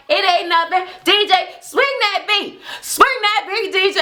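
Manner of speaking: high-pitched mock party call-out; retold sassy chant, pitched well up; Money. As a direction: imitate someone rowdily hyping the DJ.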